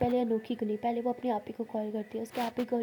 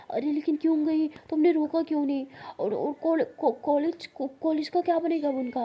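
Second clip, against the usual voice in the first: high, loud stammering shock; shocked friend; 5 semitones higher, 4 dB louder than usual. Acted. Friend hears the sister left, stammering in high loud shock. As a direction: blurt it out in alarmed disbelief, tripping over words.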